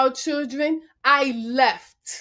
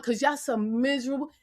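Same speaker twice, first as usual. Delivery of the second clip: usual speaking voice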